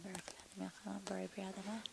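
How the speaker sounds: very soft murmured blessing; prayer. Real, not acted. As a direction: recite the blessing under your breath. Answering blessing over food.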